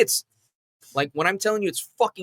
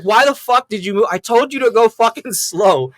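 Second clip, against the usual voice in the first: raised, loud imitation of a scolding; retold yelling; 6 semitones higher, 6 dB louder than usual. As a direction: chew him out, replaying it. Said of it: "just fucking yelling at me".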